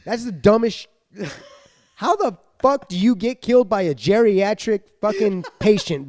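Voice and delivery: ordinary talk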